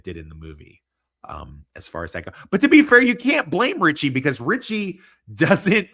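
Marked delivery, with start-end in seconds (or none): 2.5-5.9 excited. Real, not acted. Launching an argument loudly.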